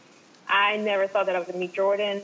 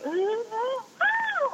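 flustered mumble; 13 semitones higher than usual. Retold or imitated. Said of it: "like mumble, stutter".

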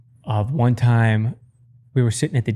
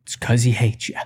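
deadpan. Retold or imitated. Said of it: "And I was like".

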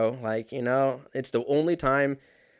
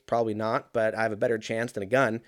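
ordinary talk

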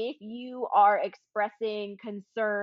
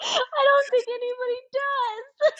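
high, slow, wheezing through laughter; barely getting it out for laughing; 14 semitones higher, 4 dB louder, slower than usual. Real, not acted. Friend replying while cracking up, high and wheezy.

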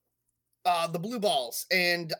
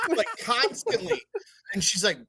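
usual speaking voice

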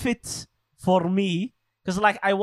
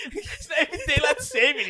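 laughing; 9 semitones higher than usual. Real, not acted. Mocking friend's line, laughing.

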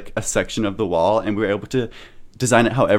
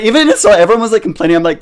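usual speaking voice

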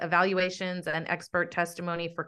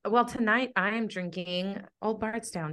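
ordinary talk

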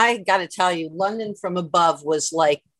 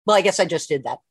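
usual speaking voice

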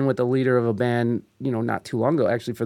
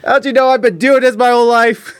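high, loud hammed-up indignation; mock outrage; 10 semitones higher, 8 dB louder than usual. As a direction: protest loudly as a wounded veteran, for laughs. Joking outburst of an offended guitarist.

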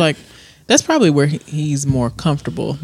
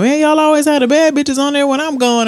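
higher, fast mock of his bragging; 11 semitones higher, 4 dB louder, faster than usual. Mocking DC bragging about the girls on his show. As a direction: ham up his boastful line. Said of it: "he was saying something about them".

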